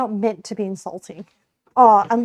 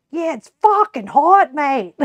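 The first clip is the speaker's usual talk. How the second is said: broad Australian accent, higher and louder; Aussie swearing demo; 6 semitones higher, 7 dB louder than usual. Shown as a demonstration. Streamer shows chat how Australians swear, broad accent. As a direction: toss off the swear like a true Aussie. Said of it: "We say".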